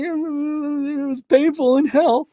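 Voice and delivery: high, slow put-on groan of a parent; lightly voiced tired parent, slow and high; mock weary parent. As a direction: drag it out like a worn-out parent. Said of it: "And like".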